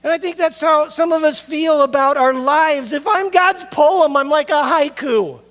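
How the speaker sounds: high, loud pulpit cry; hammered sermon peak, loud and high; preaching at full force. Acted. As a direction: preach it out over the crowd, voicing their lament.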